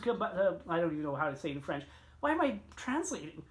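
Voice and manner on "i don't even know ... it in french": usual speaking voice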